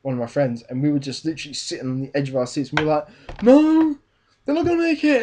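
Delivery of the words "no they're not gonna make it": high-pitched, loud mock-panicked cry; panicked disbelief. Friends watching the train race on the edge of seats, panicked disbelief. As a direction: reenact an edge-of-the-seat outcry, voice leaping up. Reenacting tense reaction to film race.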